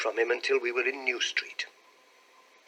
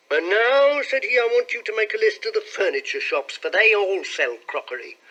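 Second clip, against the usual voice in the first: brisk instructions, pitched up high; lightly voiced employer; 8 semitones higher, 5 dB louder than usual. A narrator's reading. Employer setting his new clerk a task, lightly voiced. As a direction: give orders in a higher, livelier voice. Voices the supposed brother giving orders.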